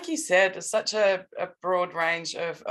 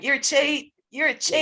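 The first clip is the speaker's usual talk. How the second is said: higher-pitched mock heckle from the stands; retold yell; 8 semitones higher, 4 dB louder than usual. Retelling a woman yelling from the stand at a swimmer, mock yell. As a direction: call it out like a protester, half-voiced shout. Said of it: "she's yelled out".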